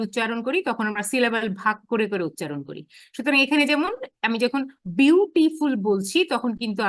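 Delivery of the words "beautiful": "Beautiful" in three separate syllables; split into syllables. Shown as a demonstration. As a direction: break "beautiful" into বিউ, টি, ফুল. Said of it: "তিনটা সিলেবলে ভাগ করে ফেলছি". ("Beautiful" তিন সিলেবলে ভাগ করে বলা)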